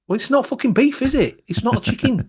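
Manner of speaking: fed up ranting at the advert; complaining to the host about vegan labels, high and indignant. Real, not acted.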